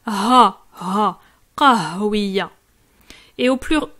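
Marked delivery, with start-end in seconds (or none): 0.1-2.5 Ha breathed lightly, then qahwiya slow with a gentle H; soft small H. Shown as a demonstration. Teacher showing a learner the soft H sound, slow and careful. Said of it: "c'est un petit H".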